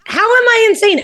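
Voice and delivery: high-pitched, incredulous comeback; real squawk of disbelief; indignant protest. Real, not acted. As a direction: push back hard, voice shooting way up. Indignant retort to being called insane.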